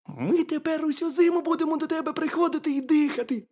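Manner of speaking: high, squeaky little hedgehog; very high, loud cartoon critter voice. A narrator's reading. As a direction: pipe it up as a tiny curious hedgehog. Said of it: "сказав їжачок".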